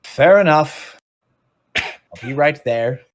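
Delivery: obliging character calling up from below; obliging creature's voice. Acted. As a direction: call back that you're on the way. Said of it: "He goes".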